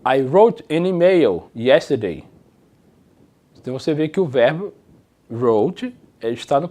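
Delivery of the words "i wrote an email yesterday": slow, careful English; slow model sentence. Shown as a demonstration. Teacher reads example sentence slowly to class. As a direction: read it slowly for learners. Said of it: "nesse exemplo aqui".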